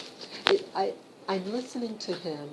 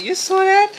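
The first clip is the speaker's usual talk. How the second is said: much higher and louder, real astonishment; amazed disbelief; 12 dB louder than usual. Real, not acted. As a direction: blurt it out, stunned by the dogs. Dogs jumping on bed, amazed.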